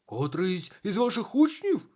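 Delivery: high, loud, aghast question; horrified. A narrator's reading. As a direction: ask it in loud dismay. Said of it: "жахнувся управитель замку".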